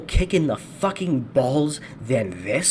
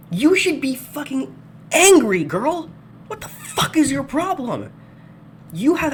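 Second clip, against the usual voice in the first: higher, louder furious yell; angry outburst; 11 semitones higher, 4 dB louder than usual. Real, not acted. Ranting at the woman in the video, furious yell. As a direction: yell at her in disbelief.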